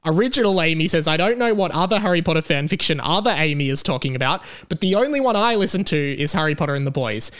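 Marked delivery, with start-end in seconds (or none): none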